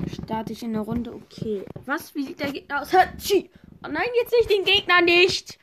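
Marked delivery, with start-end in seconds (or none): 2.9-5.6 loud high sneeze and wail; sneeze, then cry out high. Pretend.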